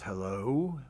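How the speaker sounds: lightly imitated Southern hello; questioning hello with a hint of a Southern accent. Bishop phoning a stranger from Tennessee, lightly imitated Southern accent. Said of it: "a man who has a Southern accent".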